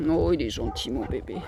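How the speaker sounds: soft, low, tender coo; tender murmur. Real, not acted. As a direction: soothe the dog gently. Cuddling dog Speed.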